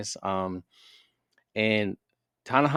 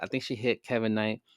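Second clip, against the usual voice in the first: usual speaking voice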